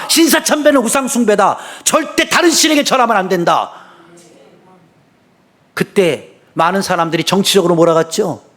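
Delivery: fervent, rising sermon voice; preaching. Real, not acted. Pastor urging the congregation to keep faith, fervent. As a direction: preach it with fire.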